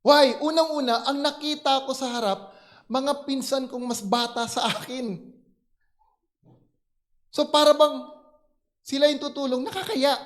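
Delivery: loud, high, urgent testimony; intense preaching. Real, not acted. Pastor to congregation, loud high urgent preaching. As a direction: drive the drowning moment home at full force. Preaching climax of drowning story.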